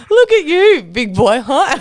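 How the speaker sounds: high, loud mock taunt at the big guy; hammed-up teasing of a muscly fighter; mock teasing shout. Drunk friend teasing a fighter.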